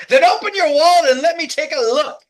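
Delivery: high, booming read-out demand; loud demand. Acted. Voicing her pushy demand.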